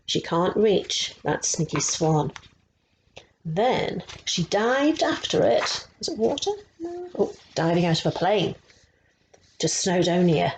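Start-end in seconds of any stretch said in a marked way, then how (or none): none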